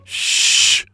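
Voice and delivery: long, voiceless shush; sustained hushing sound. A narrator's reading. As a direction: shush them with a drawn-out hiss. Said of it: "I shushed".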